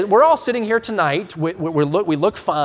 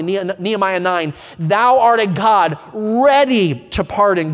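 scripture reading; 6 dB louder than usual. Acted. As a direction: read the verses out with weight. Reads Psalm and Nehemiah aloud.

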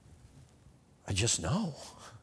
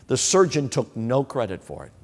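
ordinary talk

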